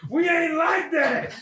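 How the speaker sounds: high, very slow shout; hammed-up drawn-out indignant yell; emphatic mock rebuff. Repeats rebuff, bigger.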